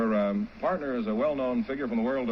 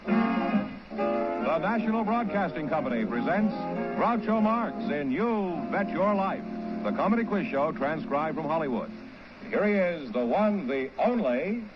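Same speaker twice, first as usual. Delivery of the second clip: high, slow, drawn-out show opening; radio announcer introduction; 3 semitones higher, slower than usual. Acted. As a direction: announce the star with rising fanfare.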